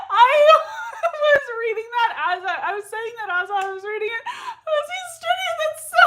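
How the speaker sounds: high-pitched helpless laughter through the words; can barely get the words out for laughing; laughing fit. Real, not acted. Host cracking up at a viewer's joke.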